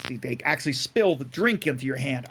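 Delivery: ordinary delivery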